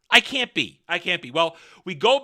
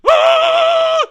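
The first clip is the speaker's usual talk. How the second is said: very high, loud sustained scream; mock singing shriek; 12 dB louder than usual. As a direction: imitate her call with a piercing "aah". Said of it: "She goes".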